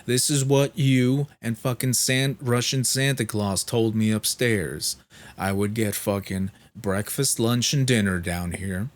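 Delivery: heated re-enacted complaint; really angry. Patient explaining broken promises to head nurse, acted-out anger. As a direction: act out an angry, emphatic rant. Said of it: "really angry".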